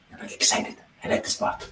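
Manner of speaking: hushed mock whisper; whispered aside. Asking the guest about bots, a playful whisper.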